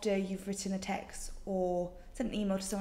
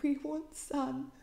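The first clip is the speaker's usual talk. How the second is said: longing plea; 4 semitones higher, 5 dB quieter, slower than usual. Real, not acted.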